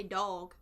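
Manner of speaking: "dog" with a rounded aw vowel; southern pronunciation. Shown as a demonstration. Explaining own southern word, "dog" as dawg. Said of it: "with an O".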